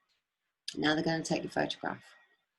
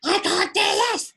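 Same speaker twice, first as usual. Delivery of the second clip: high, loud, slow outburst; imitated scream; 6 dB louder than usual. Officer losing the plot at the prisoner, screaming. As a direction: lose it in a yell. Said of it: "he's screaming".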